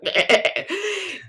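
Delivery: high bleating goat noise. Retold or imitated. Narrator recalls having to talk like a goat, bleating. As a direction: bleat in quick stutters like a goat. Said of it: "had to talk like a goat".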